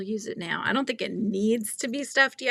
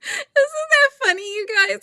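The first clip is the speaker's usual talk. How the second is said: laughing; 4 dB louder than usual. Real, not acted. Laughing at the story.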